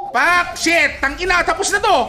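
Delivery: laughing victory shout. Real, not acted. Gamer taunting his rival after a kill, laughing shout. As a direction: crow over the kill, laughing.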